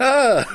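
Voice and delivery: sharp high startled cry; startled yelp. Real, not acted. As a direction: yelp as if caught off guard. Startled by the whisperer.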